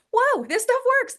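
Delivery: high-pitched, wowed exclamation; amazed delight. Acted. Someone feeling their joy return, amazed. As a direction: marvel at it, voice shooting up.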